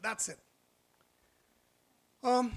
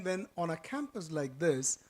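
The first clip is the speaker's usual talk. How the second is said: ordinary talk, nothing marked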